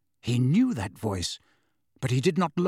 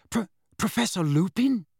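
stammering in disbelief; can't believe who he sees; disbelieving; 6 semitones higher than usual. Acted. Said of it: "he said disbelievingly".

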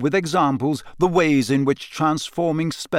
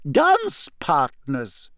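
slow, higher, pointed teacher; cold emphasis; 11 semitones higher, much slower than usual. A narrator's reading. McGonagall clarifies to Harry, slow and cold. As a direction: spell it out slowly and coldly. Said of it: "she said coldly".

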